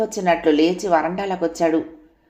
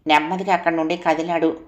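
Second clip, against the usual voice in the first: ordinary delivery, nothing marked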